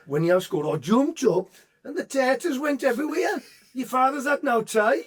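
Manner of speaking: high, rising comic mum voice; acted excited mum. Mum telling her son about his goal, high and excited.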